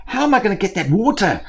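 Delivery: a bird at its wits' end; frustrated crow. Acted.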